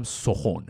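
سخن said as "sokhon"; old Persian "so-KHON" for سخن; alternative old pronunciation. Shown as a demonstration. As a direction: say سخن with o in both syllables. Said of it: "یا شاید هم سخن".

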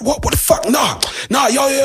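mock outraged robber shouting; angry robber. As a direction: imitate the furious robber. Robbers unhappy with his offer.

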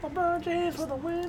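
high, soft mock commentary; a game-winning call at reduced volume; announcer play-by-play. Friend replays the LeBron call, high hushed announcer voice. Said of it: "they shoot and he goes".

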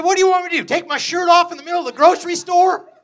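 half-laughing outburst, throw up your hands; laughing exasperated retort. Retold or imitated. To the bagger who won't stop asking, laughing exasperated shout.

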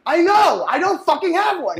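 fed up, push it out loud and high; exasperated retort. Retold or imitated. Acted reply to absurd customer.

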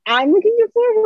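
high, loud disbelieving question; incredulous. Real, not acted. Friend repeats the misheard line back, high incredulous squeak. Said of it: "did I say you were yelling".

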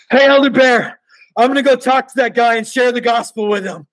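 high, loud, eager mock-American announcement. New companion announces he'll preach to the stranger, loud mock-American eagerness. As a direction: imitate a keen greenie bursting with zeal. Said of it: "talked in his loud American voice".

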